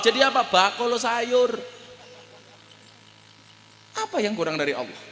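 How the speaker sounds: normal talking voice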